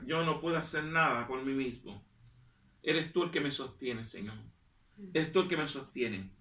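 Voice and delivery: soft, low, intimate prayer to the Lord; hushed prayer. Real, not acted. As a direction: pray quietly, close to the mic. Quiet prayer.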